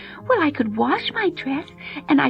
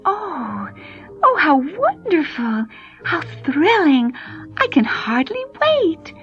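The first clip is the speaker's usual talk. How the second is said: put-on excited girl; 7 semitones higher than usual. Cinderella thrilled about the ball.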